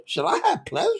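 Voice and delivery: high, very soft question; wondering Sarah. Acted. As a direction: ask it small and high, rising. Reading Sarah's question in her voice.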